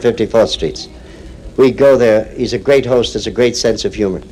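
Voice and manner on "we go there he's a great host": ordinary talk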